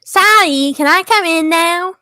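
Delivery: high, loud character voice; awkward newcomer. Acted. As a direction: pipe up awkwardly from the doorway. Voicing Tubbo awkward in doorway.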